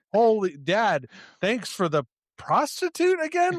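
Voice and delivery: put-on high, rising incredulous kid voice; mock naive son. Mocking Tommy's reaction to his dad, high gushing voice. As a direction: gush it like a clueless grateful son.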